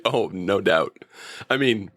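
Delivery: usual speaking voice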